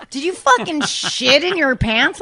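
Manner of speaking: half-laughing mock accusation; accusatory anger. Norm accusing her of shitting her pants, angry. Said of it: "it was just an accusatory anger".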